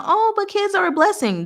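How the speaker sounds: high, sugary churchy voice; mock church member. Church member insisting kids are a blessing, high mock voice. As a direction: preach it sweetly like a pious aunt.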